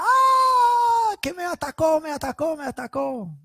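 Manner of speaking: high, loud shriek that fades out; wife crying out that he attacked her; mock scream for help. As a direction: scream that she's been attacked, then trail off. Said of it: "ella gritando a voz alta".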